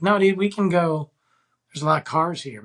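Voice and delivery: high-pitched, pleading refusal; retold protest. Refusing to be dragged to the field, high retold protest. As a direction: raise the pitch to talk her out of it.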